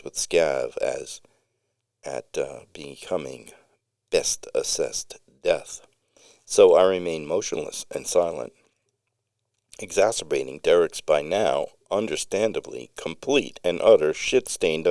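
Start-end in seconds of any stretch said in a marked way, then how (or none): none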